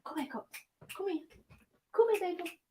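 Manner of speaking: high, coaxing call to a dog. Real, not acted. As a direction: coax her over gently, in a high voice.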